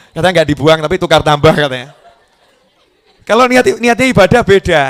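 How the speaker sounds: ordinary talk, nothing marked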